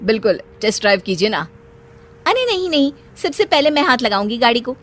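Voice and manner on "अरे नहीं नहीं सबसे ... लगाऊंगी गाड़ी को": eager protest. Acted. Sheena eagerly refuses salesman.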